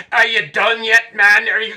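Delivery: loud, high impatient yell; barking shout. Retold or imitated. Climbing partner yelling at the exhausted climber, barking. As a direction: bark it up at a struggling climber. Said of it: "David's barking at me".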